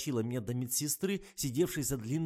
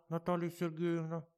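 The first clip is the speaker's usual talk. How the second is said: name quacked high, "Наталья Сергеевна" squawked through a speaker; "Наталья Сергеевна" as a quacking box voice; quacked over the intercom; 7 semitones higher than usual. Acted. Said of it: "прокрякали из ящика".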